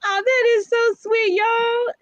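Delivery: high, moved "so sweet"; touched. Real, not acted. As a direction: gush over a kind comment. Touched by a comment.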